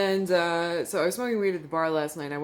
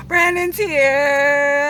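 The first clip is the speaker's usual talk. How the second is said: higher, louder, slow drawn-out greeting; excited arrival; 8 semitones higher, 11 dB louder, much slower than usual. Real, not acted. Announcing to viewers that friend Brandon has arrived, loud and delighted. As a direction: call out the friend's arrival with joy.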